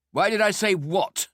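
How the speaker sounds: high, loud challenge in disgust; disgusted retort. A narrator's reading. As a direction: throw the question back, pitched high. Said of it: "a look of disgust on his face".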